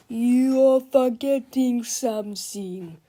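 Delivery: loud, drawn-out villain boast; slow menacing yell. A narrator's reading. Super Mega Tippy yells that he has a bomb too. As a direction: lean in and bellow the warning. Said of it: "yelled Super Mega Tippy".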